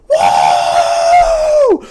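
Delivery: a huge high loud whoop; triumphant howl. Acted. Scientist celebrating his son's revival, wild triumphant whoop. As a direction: howl like you just won the lottery. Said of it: "howls like he just fucking won the lottery".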